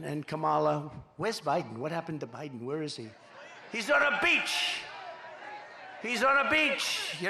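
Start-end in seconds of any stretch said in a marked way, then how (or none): 3.7-4.8 laughing mockery. Real, not acted. Mocking Biden on beach. 6.0-7.1 higher and slower, mocking; mocking repeat. Real, not acted.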